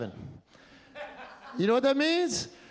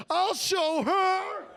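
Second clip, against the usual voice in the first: high, slow, hammed-up sobbing vow; a dumped lifter vowing revenge through fake tears; mock crying vow; 8 semitones higher, slower than usual. Heartbroken gym-goer at the bench, mock sobbing his vow. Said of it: "bench pressing and crying like".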